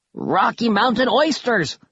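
high, drawn-out cry of shock; shocked swearing. A narrator's reading. Kaz sees his friends fall into the pit, shocked oath. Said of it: "Kaz swore in shock".

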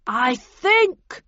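loud, slow call through a megaphone; bullhorn voice. A narrator's reading. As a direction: boom it out over a bullhorn. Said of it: "replied the voice on the bullhorn".

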